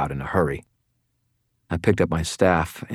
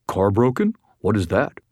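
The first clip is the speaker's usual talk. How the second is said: puzzled dog voice; 4 semitones higher than usual. A narrator's reading. Dog's imagined puzzled voice.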